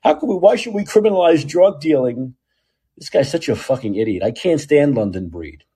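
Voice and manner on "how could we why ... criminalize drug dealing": high-pitched mocking imitation of a politician; exaggerated high, indignant protest; Dean Preston. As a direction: whine it as a mock complaint, pitched way up. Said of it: "You know, Dean Preston saying".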